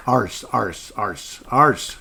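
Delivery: put-on "Arse" repeated in an Aussie accent. Host corrects his word for Aussie listeners, Aussie accent. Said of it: "Sorry, wrong accent".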